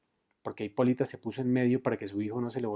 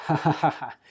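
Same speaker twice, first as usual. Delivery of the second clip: loud spoken "ja, ja, ja"; acted laugh of triumph; higher, louder narrated cackle; victorious guffaw; 4 semitones higher, 4 dB louder, slower than usual. A narrator's reading. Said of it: "soltó una risotada de victoria".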